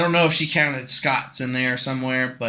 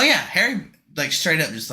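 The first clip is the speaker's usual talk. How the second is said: ordinary talk, nothing marked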